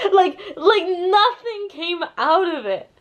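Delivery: laughing through the words. Real, not acted. Reviewer mocking a pointless plot twist, laughing.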